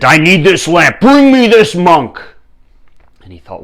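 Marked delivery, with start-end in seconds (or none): 0.0-2.2 loud, high kingly command; imperious demand. Acted. King wanting the magic lamp, imperious shout. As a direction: climb into a shouted royal order.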